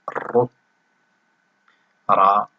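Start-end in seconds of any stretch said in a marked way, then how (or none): 0.1-0.5 rolled, held r into a short u in ru; ru with doubled r. Shown as a demonstration. Teacher showing ra with shadda and damma, doubled r in ru. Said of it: "ننطق هكذا".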